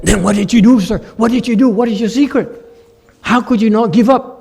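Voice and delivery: high, loud pressing interviewer; eager questioning. Retold or imitated. Interviewer pressing the old man for his secret, high and eager.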